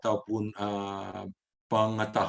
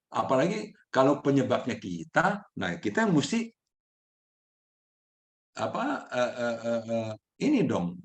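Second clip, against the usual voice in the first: usual speaking voice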